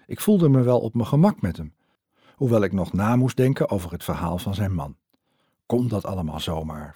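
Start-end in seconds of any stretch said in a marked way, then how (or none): none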